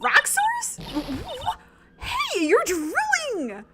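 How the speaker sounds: put-on high, shocked young girl. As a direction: squeal it in disbelief. Maya voice, indignant.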